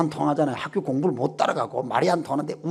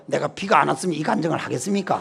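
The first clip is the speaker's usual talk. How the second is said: ordinary delivery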